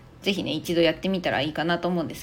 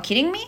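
"Kidding me" high, rising sharply on "me"; 疑問形の上げ調子; 9 semitones higher than usual. Shown as a demonstration. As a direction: lift "me" into a questioning rise. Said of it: "疑問形にねしてあげて言っちゃったりとか". ("me" を上げて高めに聞き返す)

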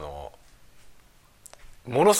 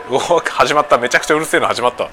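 annoyed surprise; 4 semitones higher, 6 dB louder than usual. Real, not acted. Loud construction noise starts outside.

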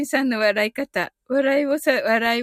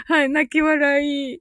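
ordinary delivery

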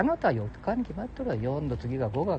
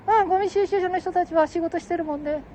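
much higher put-on voice; falsetto second voice; 14 semitones higher than usual. Companion adds who was there, high falsetto. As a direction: chirp it in falsetto.

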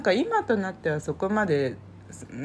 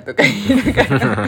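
laughing; 3 semitones higher, 10 dB louder than usual. Real, not acted. Laughing while retelling funny moment.

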